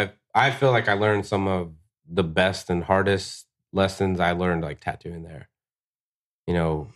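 ordinary talk, nothing marked

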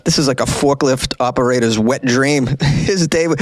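ordinary talk

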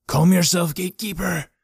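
high, strange predatory voice; menacing demon in an old man; possessed man. Acted. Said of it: "stalking closer ... of a predator".

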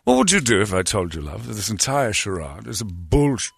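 put-on British charmer; smooth talk in a hammed-up British accent. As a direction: flirt in a mock British accent. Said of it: "unbelievable accent I've ever heard".

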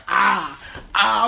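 loud mock shouts of "Ah, ah"; imitated yelling. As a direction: bellow "ah" twice like barging in. Alvin bursts in yelling.

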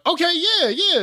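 high, hyped approval; hyped reaction. Retold or imitated. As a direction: get carried away by the beat. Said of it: "I'm like".

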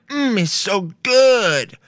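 long drawn-out moan of pleasure, slow; slow mock moan. Guy imitating a food-loving moan, slow and drawn out. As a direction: stretch each word like savoring food. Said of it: "I'm like".

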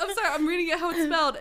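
ordinary delivery, nothing marked

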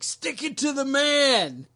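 loud, high rallying cry, drawn out; mock yell. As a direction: yell it like a joking protest slogan. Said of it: "Can I yell out".

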